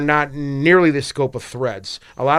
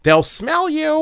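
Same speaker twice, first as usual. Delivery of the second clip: much higher, slow drawn-out creepy warning; spooky threat; 12 semitones higher, much slower than usual. Pretend. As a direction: stretch "They'll smell you" out like a horror-movie taunt.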